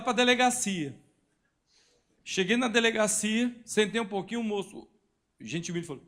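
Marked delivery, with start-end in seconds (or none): none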